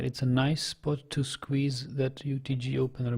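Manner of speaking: usual speaking voice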